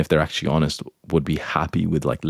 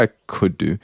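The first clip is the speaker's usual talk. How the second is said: ordinary talk, nothing marked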